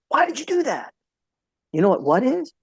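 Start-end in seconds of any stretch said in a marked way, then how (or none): 0.1-0.9 sharp, high "Why did you do that?" with "why" jumping up; "Why" as a pointed demand for an explanation; demanding justification. Shown as a demonstration. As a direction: challenge them, pitch "why" high and accusing. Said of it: "why is about justification".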